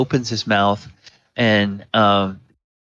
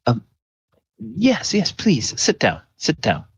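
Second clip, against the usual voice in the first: welcoming priest; 6 semitones higher than usual. Acted. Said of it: "And then he says".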